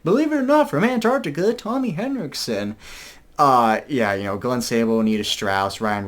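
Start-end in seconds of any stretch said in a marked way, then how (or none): 0.0-2.7 hyped announcer. Retold or imitated. Fan imitates the singer introducing the guitarist, mock announcer. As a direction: ham it up like a rock show MC. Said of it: "it'd be like".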